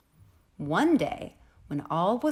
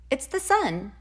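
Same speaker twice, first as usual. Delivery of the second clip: higher, bright cheery sun; soft cheery voice; 9 semitones higher, 4 dB louder than usual. A narrator's reading. The sun asks the rosebud to let it in, cheery and high. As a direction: chirp it up happily. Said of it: "said a soft, cheery voice".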